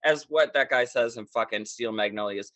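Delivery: normal talking voice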